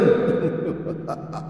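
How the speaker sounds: breathy ghostly laugh; evil laugh trailing off; demonic cackle. Acted. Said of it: "tiếng cười".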